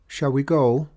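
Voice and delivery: plain reading voice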